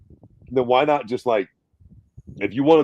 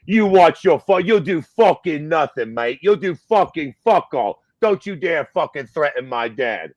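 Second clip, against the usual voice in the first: mock-angry retort, pitched up; Conor Benn; 4 semitones higher, 3 dB louder than usual. Son warning off his dad's rival, angry. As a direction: imitate a furious son squaring up, a bit louder at first. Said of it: "Conor Benn get so mad".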